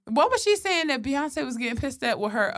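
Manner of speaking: ordinary talk, nothing marked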